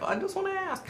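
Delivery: asking her husband's permission in a tiny whine; whiny mock self. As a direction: plead it sweetly like a small child. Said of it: "And I'm like".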